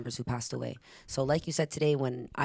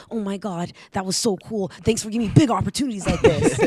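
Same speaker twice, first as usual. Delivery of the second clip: put-on excited kid; 5 semitones higher, 8 dB louder than usual. Boy thanking her excitedly.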